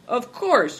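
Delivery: keen, emphatic assent; eager. A narrator's reading. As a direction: agree readily, with a lift in pitch. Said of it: "Greencoat nodded eagerly".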